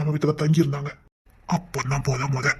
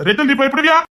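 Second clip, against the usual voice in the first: excited teasing; 9 semitones higher, 5 dB louder than usual. Acted. Teasing Rachel about ghosts.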